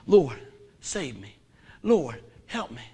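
a gentle, slow cry for help; humble prayer. Shown as a demonstration. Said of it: "Just say".

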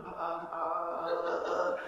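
slow, soft, helpless stammering; flustered stammer. Retold or imitated. A stumped skeptic stammering, lost for an answer. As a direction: stumble for words like you're caught out. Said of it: "their lips going all over here".

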